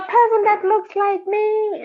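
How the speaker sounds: high, loud excited exclamation. Retold or imitated. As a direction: burst out in delight. Excited retold thought on seeing someone.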